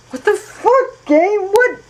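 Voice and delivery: sudden high startled outburst. Real, not acted. Player startled by something in the game, high yelp. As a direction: jump at the screen and blurt it out.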